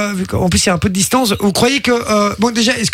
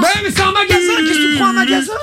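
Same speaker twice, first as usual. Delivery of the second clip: higher, stammering shout of disbelief; exasperated outburst; 7 semitones higher than usual. Real, not acted. Host rants at the team after calling a shop, high and stammering. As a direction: blurt it out, laughing at the blunder.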